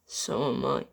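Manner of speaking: soft, sorrowful confession; soft sad voice trailing into a whisper; grieving murmur. Acted. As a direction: fade to a near-whisper of guilt. Thomas grieving softly.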